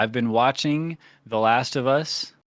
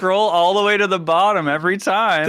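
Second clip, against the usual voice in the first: high laughing words; 8 semitones higher, 5 dB louder than usual. Real, not acted. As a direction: talk through a laugh. Laughing about own notes.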